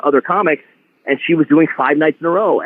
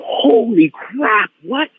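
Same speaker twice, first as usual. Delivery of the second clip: stunned surprise; 7 semitones higher, much slower than usual. Retold or imitated. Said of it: "And I go".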